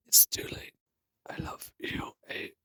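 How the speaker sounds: fading, breathless last words; dying whisper. Acted. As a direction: whisper a goodbye with no strength left.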